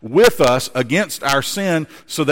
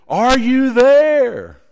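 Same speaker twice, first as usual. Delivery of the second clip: high, slow call across the mountains; boy calling out loud into the valley; 10 semitones higher, 7 dB louder, much slower than usual. Acted. Boy calls to echo mountain.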